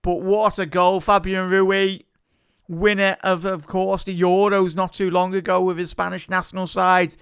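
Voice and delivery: high, loud play-by-play; live commentary. Acted. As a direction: commentate the replay with energy. Play-by-play after goal.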